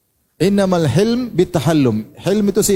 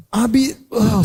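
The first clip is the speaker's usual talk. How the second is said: higher, about-to-scold call; mock raised voice; 5 semitones higher, much slower than usual. Wife coming home about to scold her husband, voice raised. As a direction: start to scold, then catch yourself. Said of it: "mau angkat suara".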